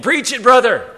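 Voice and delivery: loud, high shout of encouragement from the pews; congregation shout. Acted. Southern churchgoer calling to the preacher mid-sermon, hearty shout. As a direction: holler it up to the preacher, pitch leaping then falling. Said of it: "a voice from the congregation".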